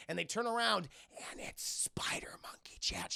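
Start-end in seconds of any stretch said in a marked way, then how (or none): 1.3-3.1 hushed, whispered reveal; dramatic whisper. Acted. Streamer revealing the returning villain to chat, stage whisper. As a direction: whisper the twist for suspense.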